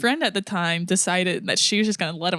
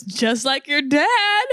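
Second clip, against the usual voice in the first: higher, drawn-out teasing punchline; teasing jab; 7 semitones higher, 4 dB louder, much slower than usual. Retold or imitated. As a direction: draw it out slowly, pitched up, as a tease. Friend's teasing jab, retold.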